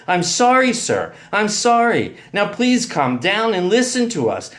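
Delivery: high, loud pleading apology; urgent apology. A narrator's reading. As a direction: try to calm a raging man. Boyfriend placating raging uncle.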